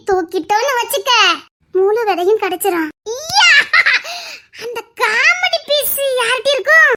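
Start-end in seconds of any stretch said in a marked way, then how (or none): 3.1-3.5 high startled yelp; sudden cry. Acted. Startled villain crying out at the discovery. 4.6-6.9 pitched-up theatrical whine; high scheming wail. Acted. Schemer wailing aloud about who has it.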